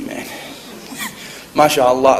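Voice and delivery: usual speaking voice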